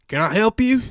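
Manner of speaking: high, loud, put-on voice of the man at the door; loud put-on voice of an annoyed stranger; mock gruff guy at the door. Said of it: "This guy goes".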